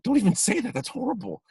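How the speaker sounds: very high, mock-aghast plea; horrified protest. Casting director begging the actor not to say it, horrified. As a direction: squeal it in exaggerated horror.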